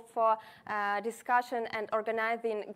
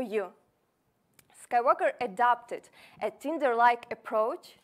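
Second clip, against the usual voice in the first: upbeat, punchy sales pitch; confident startup pitch; 4 dB louder than usual. Acted. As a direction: sell it to investors with confidence.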